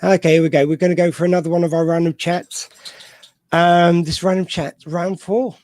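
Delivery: loud, upbeat host intro; show host announcement. Acted. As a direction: kick off the segment with energy. Announces the next segment.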